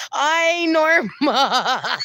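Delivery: high, teasing cry breaking into laughter; exclaim, then dissolve into laughing. Real, not acted. Cracking up at Norma's story.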